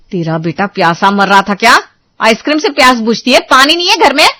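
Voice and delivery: mock her excuse with rising fury; woman taunts sister-in-law over the ice cream; angry taunt. Quarrel, mocking the ice cream.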